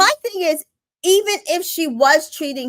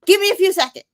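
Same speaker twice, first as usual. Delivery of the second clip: mock yell; 7 semitones higher, 3 dB louder, much faster than usual. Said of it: "yells at her for a few seconds".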